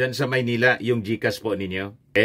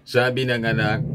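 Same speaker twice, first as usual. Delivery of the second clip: ordinary delivery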